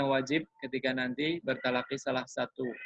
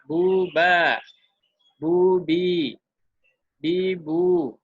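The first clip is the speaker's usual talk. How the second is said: louder, each syllable set apart; demo reading; 4 semitones higher, 7 dB louder, much slower than usual. Shown as a demonstration. Teacher shows how to read dhammah syllables, loud and even. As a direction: read it out as the model for students. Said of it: "Dibolak-balik juga bisa".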